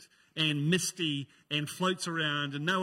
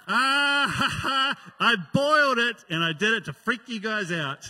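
louder, higher hammed-up villain cackle into the line; mock gloating laugh; 4 semitones higher, 9 dB louder than usual. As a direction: cackle like a cartoon prankster, much louder. Mock villain gloating over prank.

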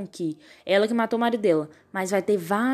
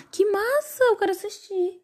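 excited; 10 semitones higher, slower than usual. Real, not acted. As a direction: gasp then high excited. Excited reaction to film.

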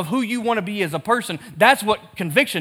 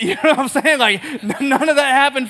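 laughing; 6 semitones higher, 3 dB louder than usual. Real, not acted. Laughs at his own joke.

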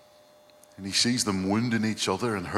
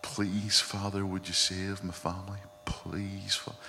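soft, hushed imploring prayer; imitating a quietly reverent prayer; tender, gentle plea; 7 dB quieter than usual. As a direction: plead gently, almost fading to a whisper. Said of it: "tender, gentle manner she had".

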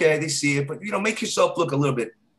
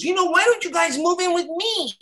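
much higher, breathy-free sweet invite to move in; high flirty woman. Retold or imitated. Neighbour Candy inviting the guys to move in, high sweet woman voice.

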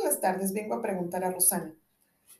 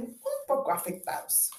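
disdainful; 6 semitones higher than usual. Acted. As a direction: sniff at the verses. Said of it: "refrenándose y con desdén".

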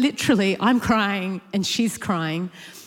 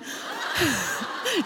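quieter trailing laugh; soft laugh; 6 semitones higher, 5 dB quieter than usual. Real, not acted. Still laughing at the camo story, trailing off. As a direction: let the laugh fade out.

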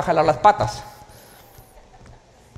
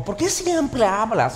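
wife's quote; 4 semitones higher, slower than usual. Retold or imitated. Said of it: "me lo dijo así".

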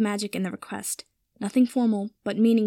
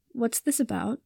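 slightly lowered, guarded question; wary question; 3 dB quieter than usual. A narrator's reading. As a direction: ask warily, a touch held back. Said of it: "Harry asked warily".

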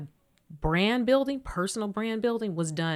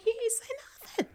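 incredulous aside; 6 dB quieter than usual. Retold or imitated. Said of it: "I'm like".

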